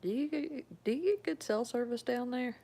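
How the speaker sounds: high, swooping Southern twang; Southern lilt, pitch jumping on each question; acted Southern question. Acting out Southern talk about hollers.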